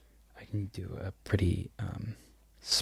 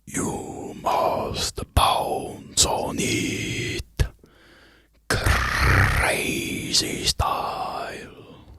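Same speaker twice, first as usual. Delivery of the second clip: very slow, drawn-out whispered vampire voice, dropping much lower; Nosferatu impression; 9 semitones lower, 4 dB louder, much slower than usual. ASMR whisperer shows viewers her Nosferatu, slow eerie whisper. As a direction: creep it out like a lurking ghoul. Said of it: "So that's my Nosferatu impression".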